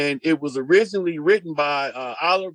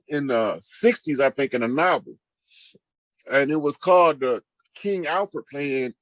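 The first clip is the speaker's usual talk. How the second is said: ordinary talk, nothing marked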